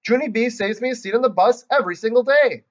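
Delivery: put-on little girl shouting an answer; shouting back. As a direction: shout back across the room. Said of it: "That Grace shouted back".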